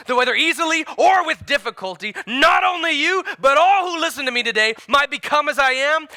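high, loud declaration; bold proclamation. Acted. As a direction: proclaim it to the whole room. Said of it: "replied Paul".

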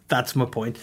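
normal talking voice

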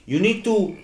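put-on high, slow, over-careful Mexican-accented English; imitating a thick-accented coworker, stretched slow and high; mocking Joel's accent. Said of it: "'cause he talks like this".

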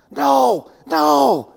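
high, forceful mock whisper-yell; a hushed yell pushed up high and hard. Preacher acting his panic at the bird while everybody's sleeping, whisper-yelling. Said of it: "I started whisper yelling".

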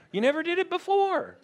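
very high put-on child voice; high childlike squeal. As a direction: jump up into a squeaky, strained little voice. Acting the suspicious child's plea.

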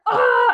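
sudden high, loud roar of rage; mock attack scream, high and loud; angry yell. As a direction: let out a furious battle cry. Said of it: "and Rob's like".

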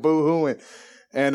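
ordinary talk

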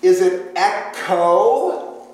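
slow questioning echo; drawn-out echo. Shown as a demonstration. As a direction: echo slowly stretched, long open o. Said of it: "the difference between an omega and an omicron".

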